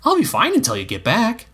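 put-on high boy's voice with a smirk; boyish, high-pitched teasing; Egg. A narrator's reading. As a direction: assure cheekily, pitched high. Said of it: "assured him with a smirk".

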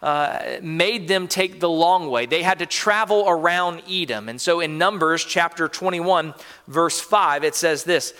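ordinary talk, nothing marked

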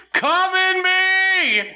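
high drawn-out mock cry; mock yell. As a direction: stretch it out high, hammed up. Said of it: "[name] just yelled".

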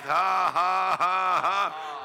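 a loud demonstration laugh; laughing in faith. Shown as a demonstration. Preacher showing the church how to laugh in faith. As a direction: laugh out for the congregation to join. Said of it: "You start laughing in faith".

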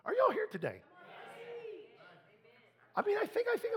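calling out to crowd. Real, not acted. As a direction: rouse the room with a shout. Preacher calling out to congregation.

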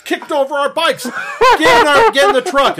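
high, hollering biker impression; mock outraged yell. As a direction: imitate furious bikers rallying the gang. Bikers' outraged cries, retold with laughter.

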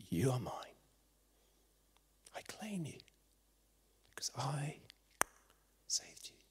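near-whisper with long pauses; hushed preaching. Acted. Jesus claims the listeners as his own, whispered.

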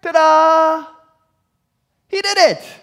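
high, loud sarcastic fanfare; mock triumphant. Preacher mocks David's scheme with a loud ta-da. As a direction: announce it like a magic trick. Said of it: "mock celebration of David".

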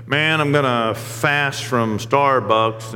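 very slow, lightly parodied announcement; mock boasting. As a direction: stretch the words in mock resolve. Mocks people's token fasting boasts.

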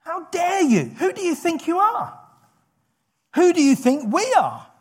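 high-pitched indignant challenge; offended. Acted. David rebuking Goliath for defying God's armies, offended. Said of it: "David is offended".

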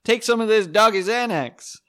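comic voicing himself talking to his dog; mock bro voice. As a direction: hype it like a buddy. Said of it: "I'm like".

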